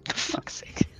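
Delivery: ordinary delivery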